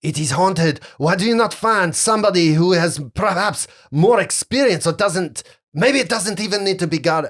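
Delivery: put-on high, agitated French-accented pleading; fearful French accent. Frightened captain begs off guarding, French accent. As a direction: talk them out of it, French accent. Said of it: "you can see fear almost come over him".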